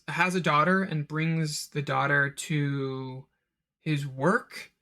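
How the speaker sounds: usual speaking voice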